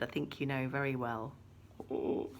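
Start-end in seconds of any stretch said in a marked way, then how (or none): none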